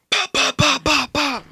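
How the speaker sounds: high popping gunshot sounds; imitated gunshots. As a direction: mimic rapid gunfire.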